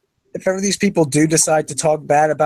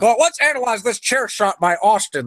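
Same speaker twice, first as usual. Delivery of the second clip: mock announcer; 6 semitones higher than usual. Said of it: "analyzing it like a sports show".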